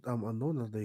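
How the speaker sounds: ordinary talk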